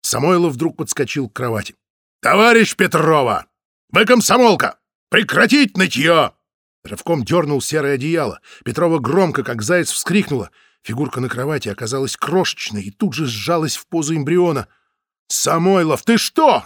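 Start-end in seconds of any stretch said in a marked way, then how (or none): 2.2-6.3 loud barked command, high and hard; shouting order. A narrator's reading. Samoylov barks at the crying girl, loud command. 15.3-16.6 loud, high alarmed yell. A narrator's reading. Zaytsev yells at Samoylov, loud alarmed shout. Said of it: "заорал Зайцев".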